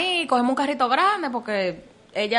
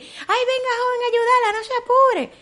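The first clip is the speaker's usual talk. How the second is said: high mock guard voice, much higher; mimicking security guard; 12 semitones higher than usual. Security guard telling him not to worry, high mock voice. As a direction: put on a high fussy guard voice.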